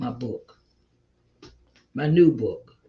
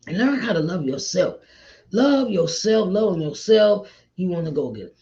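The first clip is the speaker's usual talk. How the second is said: normal talking voice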